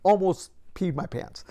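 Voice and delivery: ordinary delivery, nothing marked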